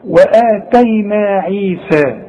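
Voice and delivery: وآتينا with a thin, stretched alif, slow; light alif. Shown as a demonstration. Teacher showing a light alif while reciting. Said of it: "برضو مرققة".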